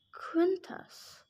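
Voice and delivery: soft, thoughtful question; musing. Acted. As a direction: wonder aloud softly, to himself. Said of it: "Gary mused".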